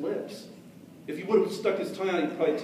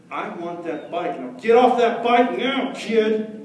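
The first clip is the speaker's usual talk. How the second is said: slow, louder mock threat; menacing demand; 4 semitones higher, 6 dB louder, slower than usual. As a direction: lean on him, building menace. Bully demanding the bike.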